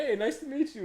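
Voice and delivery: high, politely voiced greeting; professional dad. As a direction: imitate a dad being very professional. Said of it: "very professional".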